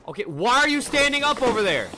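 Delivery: freaked out by a creepy figure; scared horror game streamer. Real, not acted.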